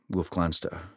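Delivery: plain reading voice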